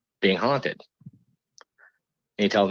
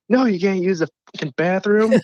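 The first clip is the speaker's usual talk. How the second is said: high-pitched exasperated mock yell; mock scream; 11 semitones higher than usual. Man woken at night answers the whisperer, mock scream. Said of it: "in the middle of the night screaming".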